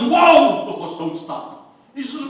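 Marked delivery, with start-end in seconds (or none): 0.0-0.7 loud high panicked yell; hollering whoa. Acted. Rider yelling at his runaway horse, full panic shout. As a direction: yell it like the horse is running off a cliff. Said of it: "He hollers".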